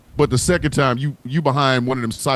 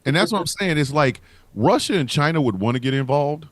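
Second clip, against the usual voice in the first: usual speaking voice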